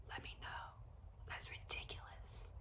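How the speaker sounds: whispered, choked. Real, not acted. Emotional host whispers to grieving viewers, barely voiced.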